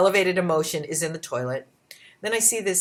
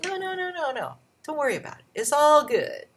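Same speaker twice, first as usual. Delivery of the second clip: higher, breezy brush-off of the offer; kind stranger; 9 semitones higher, 3 dB louder than usual. Retold or imitated. Kind store worker refusing payment for the blisters, breezy and high. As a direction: wave off the repayment cheerfully. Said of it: "She goes".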